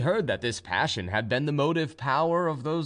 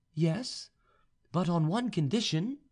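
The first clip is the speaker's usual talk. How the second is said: high, composed feminine voice; young noblewoman; 5 semitones higher than usual. A narrator's reading. Vaninka tells the suitor what to do, high and composed. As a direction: instruct him in a high voice. Heroine sets her condition.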